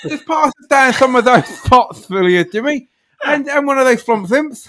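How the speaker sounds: high, loud hammed-up TV chef; mock celebrity chef voice. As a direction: send up a cheerful TV cook. Mocking TV chef again.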